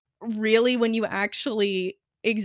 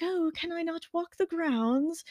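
prim lady voice; 7 semitones higher, 4 dB quieter, slower than usual. Retold or imitated. [name] pretends to be Josette.

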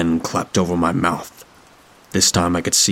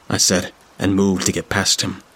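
ordinary delivery

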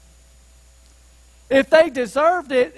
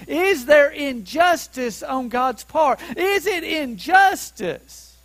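higher-pitched, ringing rhetorical question; impassioned challenge; 3 semitones higher than usual. Real, not acted. Preacher pressing Paul's question on the congregation, impassioned and raised. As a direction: throw the question out with force.

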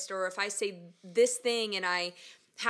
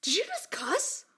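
mock shock; 8 semitones higher than usual. Cousins' shocked question, acted.

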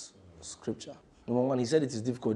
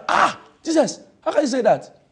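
much higher, louder disbelieving protest; shocked disciples; 10 semitones higher, 7 dB louder than usual. Acted. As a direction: plead in disbelief with Jesus.

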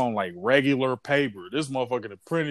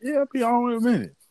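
quick reply; 11 semitones higher, faster than usual. Retold or imitated. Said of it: "I'm like".

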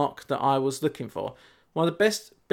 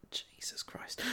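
hushed mock disbelief, whispered; aghast whisper; 21 dB quieter, slower than usual. Host looking back on what he once did, aghast whisper. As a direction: whisper it as if appalled at yourself.

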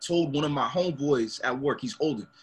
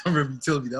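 ordinary delivery

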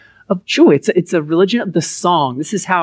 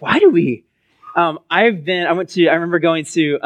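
ordinary talk, nothing marked

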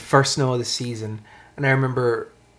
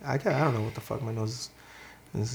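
ordinary talk, nothing marked

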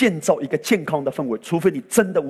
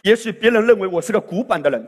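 normal talking voice

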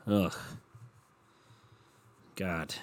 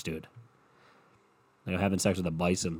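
usual speaking voice

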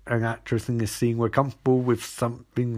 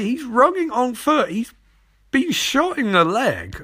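very high, loud disbelief; worked-up, high-pitched scolding; exasperated rant; 11 semitones higher, 3 dB louder than usual. Real, not acted. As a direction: berate him in a squeaky, incredulous pitch. Exasperated rant at the character Barry.

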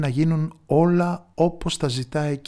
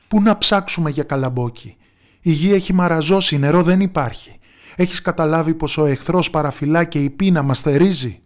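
higher, louder angry protest; enraged crowd, higher and louder; furious villagers; 4 semitones higher, 7 dB louder than usual. A narrator's reading. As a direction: hurl it at her in outrage. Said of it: "είπαν εξαγριωμένοι".

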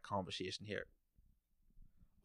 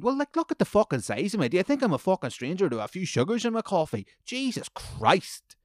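much higher and louder outrage; exasperated shout; 10 semitones higher, 6 dB louder than usual. Real, not acted. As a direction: yell it in disbelief. Venting at the barista.

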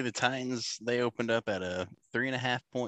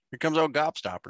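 higher, louder mock announcer hype; 6 semitones higher, 3 dB louder than usual. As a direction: call him in like a hammy commentator. Mock announcer calling nickname.

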